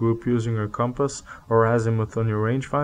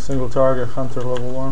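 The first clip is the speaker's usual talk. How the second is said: loud, urgent target callout; 2 semitones higher, 10 dB louder than usual. Real, not acted. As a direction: call it out over gunfire. Calling out target in firefight.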